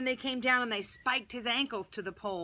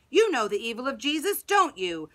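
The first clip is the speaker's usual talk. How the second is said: loud, urgent call to a crowd; crying out; 3 semitones higher, 8 dB louder than usual. A narrator's reading. High priest turns to the gathering people, crying out. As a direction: rouse the crowd, voice raised. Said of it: "turned to them and cried".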